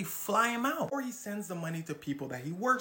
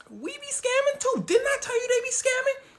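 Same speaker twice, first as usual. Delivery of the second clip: much higher, loud silly confession; mock government; 8 dB louder than usual. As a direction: blurt it out cheerfully. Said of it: "The Nigerian government goes".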